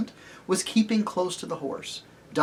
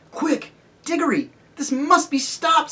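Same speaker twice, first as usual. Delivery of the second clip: put-on high, urgent girl's voice; urgent Polly; 12 semitones higher than usual. Polly urging Diggory to stop the witch, high and urgent. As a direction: press him to act now, pitched up high.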